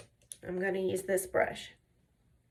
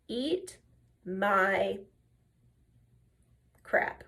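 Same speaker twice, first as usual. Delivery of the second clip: word by word, with long pauses; deliberate retold defiance; much slower than usual. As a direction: hold back each word for dramatic effect. Retold defiant line, drawn out.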